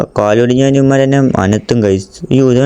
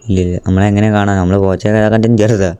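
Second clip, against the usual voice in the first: ordinary talk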